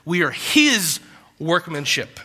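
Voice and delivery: "his" stretched out and set apart; slow down and lean hard on "his"; weighty, drawn-out "his" before "workmanship"; stress on his. Shown as a demonstration. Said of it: "he puts emphasis on the word his".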